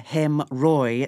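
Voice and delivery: "Hem, Roy" spoken as two separate beats; split syllables. Real, not acted. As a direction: say "Hem" then pause, then "Roy", with no D. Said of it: "The D is silent".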